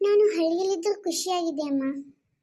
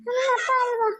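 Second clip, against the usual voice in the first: tearful crying; 5 semitones higher than usual. Acted. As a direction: cry it out, begging why. Daughter cries over her father.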